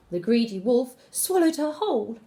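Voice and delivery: plain reading voice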